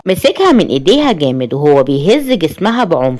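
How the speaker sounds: ordinary delivery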